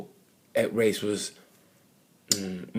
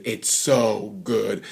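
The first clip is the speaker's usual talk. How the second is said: savoring; much slower than usual. Real, not acted. Savoring Moto3.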